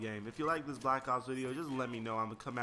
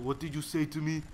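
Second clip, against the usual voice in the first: put-on indignant challenge in an Israeli accent; lightly imitated Israeli accent; 3 semitones higher, 4 dB louder than usual. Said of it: "in their Israeli accent".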